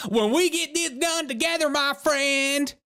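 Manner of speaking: high-pitched, exaggerated Southern twang; mock Southern accent. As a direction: mimic her Southern accent, hammed up. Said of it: "uh, southern accent".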